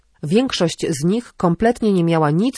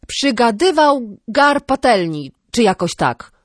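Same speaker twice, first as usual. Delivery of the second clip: slow, higher snarky retort; 6 semitones higher, 4 dB louder, slower than usual. A narrator's reading. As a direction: bite back slowly, half-unsure of the proverb. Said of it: "odgryzła się".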